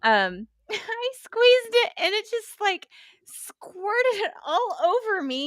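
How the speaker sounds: high-pitched laughing through the words. Real, not acted. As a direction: giggle while telling it. Telling funny blood story, laughing.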